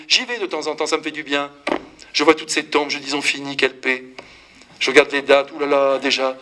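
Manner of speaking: normal talking voice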